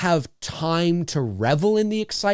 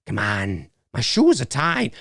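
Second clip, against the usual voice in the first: halting impression with odd stretched words; Christopher Walken; slower than usual. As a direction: break the line up oddly, drawing words out slowly. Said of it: "in the Christopher Walken voice".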